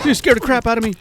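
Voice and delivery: high, rattled after a fright; shaken and scared. Real, not acted. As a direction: blurt it out still shaken. Just startled by zombie.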